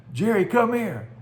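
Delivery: high, loud call to a friend; urgent retold call to Jerry. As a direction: shout to Jerry across the yard. Man calls friend while filming tornado.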